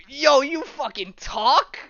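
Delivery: high, loud, drawn-out disbelief; hammed-up incredulous question; astonished. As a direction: blurt it out, stunned at a talking dog. Man astonished that dog talks.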